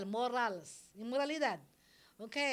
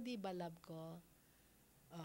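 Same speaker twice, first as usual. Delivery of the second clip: usual speaking voice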